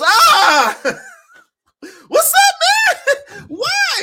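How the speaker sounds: high, loud laughing yelp of a fouled player; hammed-up high shout of the fouled player, cracking up; mock Ant-Man outcry. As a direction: squeal it out in mock protest, laughing. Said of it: "It goes".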